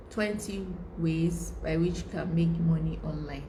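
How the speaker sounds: ordinary talk, nothing marked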